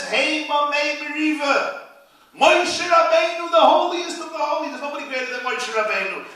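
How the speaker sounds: rising preacher's fervour; fiery preaching. Acted. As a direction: thunder it from the pulpit. Preaching at full force.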